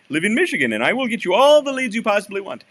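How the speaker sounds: bad Michigan accent. Mimicking a cold-caller promising leads, bad Michigan accent. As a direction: ham up a poor Michigan accent. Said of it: "I'm doing a bad Michigan accent".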